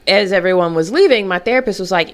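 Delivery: normal talking voice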